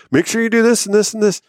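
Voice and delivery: high nagging wife list; high wife voice listing chores; mock wife.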